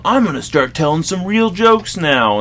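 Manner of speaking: high, loud, drawn-out mock announcement; slow, big stage-voice boast; cocky comedian. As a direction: imitate an overconfident comic opening the set. Quoting a cocky comedian opening.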